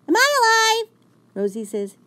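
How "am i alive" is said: very high, loud, dazed; tiny shocked question; small dog voice. A narrator's reading. Fifi asks if she is alive, very high. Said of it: "Fifi says".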